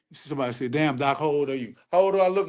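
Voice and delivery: ordinary delivery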